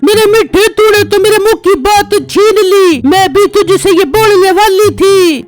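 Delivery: wife's voice. Acted. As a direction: play the scheming wife cooing at her husband. Narrator voices the wife. (पत्नी की ऊँची, मीठी आवाज़)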